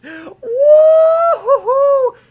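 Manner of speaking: very high, drawn-out taunting woo-hoo; mocking whoop. Real, not acted. Jeering the LA fans with a long whoop. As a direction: hoot at the losing fans.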